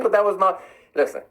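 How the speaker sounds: ordinary talk, nothing marked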